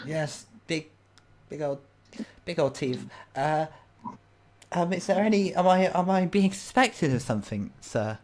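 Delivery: falter, then ask anxiously; timid suspect addressing an officer; nervous old lady. Acted. Nervous old lady being questioned.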